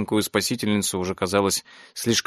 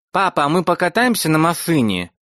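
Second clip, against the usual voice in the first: put-on wheedling child; 6 semitones higher than usual. A narrator's reading. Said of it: "заискивающе ухватилась".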